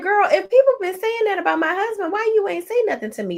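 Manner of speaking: mimicking an outraged wife; Rashida quoting. Retelling Rashida scolding the ladies, high fast indignant voice. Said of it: "Rashida is like".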